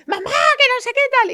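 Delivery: put-on high, loud child's shout. Child shouting at his mother, high and loud. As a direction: yell it like a wild kid. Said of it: "Y dice".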